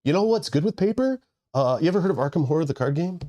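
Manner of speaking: high, fast sales pitch; pushy salesman voice. Retold or imitated. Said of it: "people were like".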